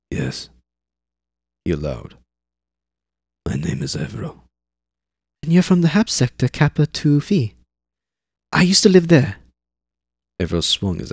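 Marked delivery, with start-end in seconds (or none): 0.1-4.5 low, reluctant man's reply; gruff big man. Acted. Eivor answers the pestering girl, low and grudging. 5.4-9.4 put-on high girl's eager questions; pry like a nosy kid; curious girl. Zane quizzes Eivor about home, high and curious.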